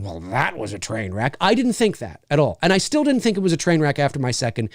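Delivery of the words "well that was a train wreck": mock verdict. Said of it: "walk out of the theater going".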